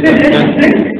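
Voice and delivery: very high, loud, drawn-out mimicry; comic high-pitched sound effect; imitated TV sound. Imitating the series' sound, laughter.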